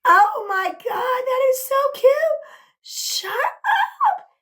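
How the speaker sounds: very high, loud excited squeal; overjoyed, pitch shooting way up; delighted squeal. Real, not acted. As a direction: squeal with real delight at a surprise gift. Delighted by a new gift.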